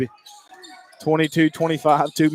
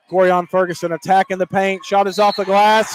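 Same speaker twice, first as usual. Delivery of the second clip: excited, building play-by-play; 4 semitones higher, 5 dB louder than usual. Real, not acted. Announcer calls Ferguson's tying basket, building to an excited shout. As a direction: climb from raised voice to high shout.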